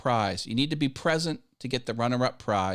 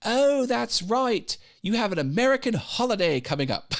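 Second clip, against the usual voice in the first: chirpy imitation of a colleague; lilting put-on English voice; mimicking English colleagues; 12 semitones higher, 4 dB louder than usual. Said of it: "And like".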